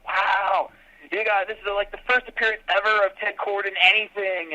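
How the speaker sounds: mock breathless fan chatter; gushing fans. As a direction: gush like a thrilled fan online. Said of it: "they're all talking about".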